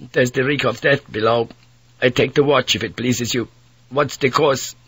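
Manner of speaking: put-on a weary young Dutch sailor pleading; Dutch mate. Mate offers watch again.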